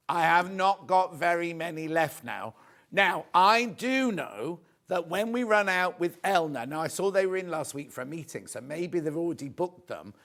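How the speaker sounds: normal talking voice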